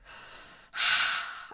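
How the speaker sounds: long, audible breath out; demonstrated exhale. Acted. Sam breathing Dean through panic, demonstrated exhale. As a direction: let out a slow calming breath.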